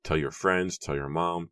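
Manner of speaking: plain reading voice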